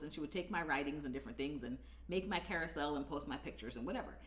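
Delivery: ordinary talk, nothing marked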